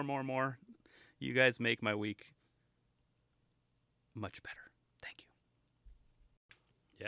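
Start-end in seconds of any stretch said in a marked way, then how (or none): 4.1-7.1 whisper. Real, not acted.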